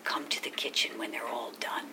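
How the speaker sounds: hushed, conspiratorial whisper; acted-out secret whisper. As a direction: whisper it low, like a secret invitation. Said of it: "and they'd whisper".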